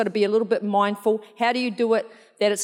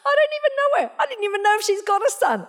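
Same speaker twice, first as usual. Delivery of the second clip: much higher, squeaky disbelieving voice; squeaky mock protest; 11 semitones higher, faster than usual. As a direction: protest to God in a high squeak. Mock protest to God, laughing.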